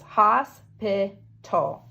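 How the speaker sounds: Hospital said slowly, each syllable drawn out. Shown as a demonstration. Teacher counting syllables aloud, slowly. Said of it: "So three syllables".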